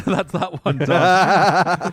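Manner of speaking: laughing through the words. Real, not acted. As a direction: chuckle it out slowly. Laughing after patron read.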